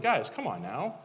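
fed-up plea to grumblers; frustrated scolding. Retold or imitated. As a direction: come on, do better. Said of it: "I get frustrated".

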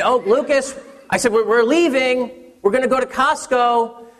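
high, coaxing call down the hall; sing-song calling. Retold or imitated. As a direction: call out like dangling a treat. Mom lures son with surprise.

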